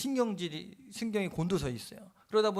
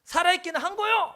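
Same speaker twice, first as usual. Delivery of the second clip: furious retold shout, much higher and louder; angry man demands whether God even exists; yelling at God; 12 semitones higher, 10 dB louder than usual. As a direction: hurl the challenge at God, pointing a finger. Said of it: "하면서 막 삿대질하면서 따졌대요".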